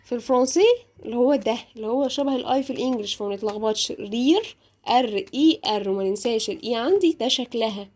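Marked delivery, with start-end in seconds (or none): none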